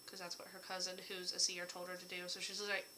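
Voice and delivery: usual speaking voice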